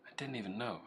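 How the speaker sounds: soft, muttered to self; quiet. Real, not acted. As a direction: drop it right down, quiet. Repeats line, loud then soft.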